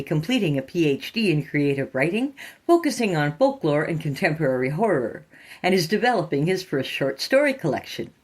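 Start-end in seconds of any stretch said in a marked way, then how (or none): none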